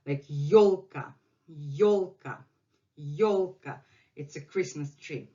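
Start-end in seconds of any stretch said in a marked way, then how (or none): 0.3-3.8 "yolka" stressed on the first syllable; YOLka, weight on the yo. Shown as a demonstration. Teacher showing that yo attracts stress, YOL in "yolka". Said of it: "your vowel to put the stress".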